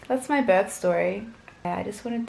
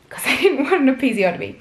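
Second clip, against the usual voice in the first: laughing; 6 semitones higher, 8 dB louder, faster than usual. Real, not acted. Laughing about avoiding an episiotomy.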